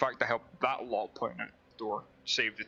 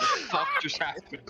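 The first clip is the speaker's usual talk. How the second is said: stunned outburst; 4 dB louder than usual. Acted. Stunned character outburst, laughing.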